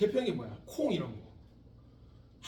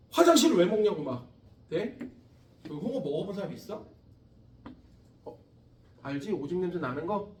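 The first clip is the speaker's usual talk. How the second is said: normal talking voice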